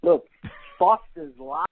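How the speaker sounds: Australian Foster's Lager, no R sounds, "Lager" ending in an open ah; Australian accent. Shown as a demonstration. Guest teaches host the Australian way, Australian accent. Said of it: "That's how you say it".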